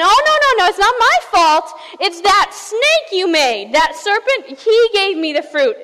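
loud, high-pitched protest; Eve. Acted. Eve chimes in to blame the serpent, loud and defensive.

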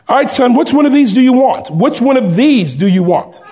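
high, loud, fast forced cheer; pushy cheerful father. Retold or imitated. Father pressing his son to pick a cheap bike, forced cheer. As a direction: hurry the kid into choosing.

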